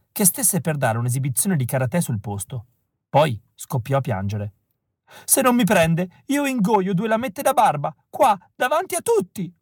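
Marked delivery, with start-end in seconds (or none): 5.2-9.5 high, strained wail, close to sobbing; tearful scream. A narrator's reading. Pale boy rejected at the audition screams at the casting man. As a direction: scream it out through tears, threatening. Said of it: "urlò".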